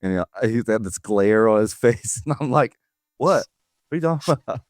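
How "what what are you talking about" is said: high-pitched, playful feigned innocence; mock innocence. Dad to glaring son after a prank, mock innocence. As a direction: play dumb in a higher voice, amused.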